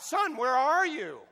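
slow, loud, high frantic call for his lost son; desperate cry. Retold or imitated. Father searching the bridge for his son, a frantic shout. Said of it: "he cried out".

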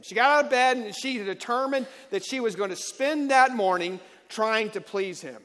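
loud, rising sermon delivery, driving each point home; preaching at full force. Acted. Pastor to congregation, preaching at full force.

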